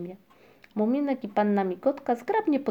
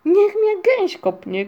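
loud, high, drawn-out exclamation; shouting; 11 semitones higher, 9 dB louder, slower than usual. A narrator's reading. Muminek sees the cloud move, shouting. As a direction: shout it in slow amazement. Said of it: "krzyknął Muminek".

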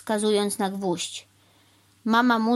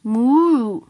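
long, loud moo pitched up; cow moo; 4 semitones higher, 9 dB louder than usual. A narrator's reading. Cow answering the crow, loud moo.

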